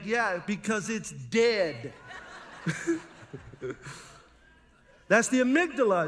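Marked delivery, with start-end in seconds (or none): 2.0-3.0 short high airy chuckle; high breathy laugh. Real, not acted. Preacher laughing at his snake joke, high and airy. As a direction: laugh lightly at your own punchline.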